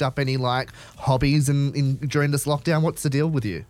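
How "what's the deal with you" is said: usual speaking voice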